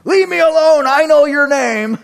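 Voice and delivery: high, loud demon cry. Acted. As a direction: wail it out as the demon. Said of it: "what this demon was attempting".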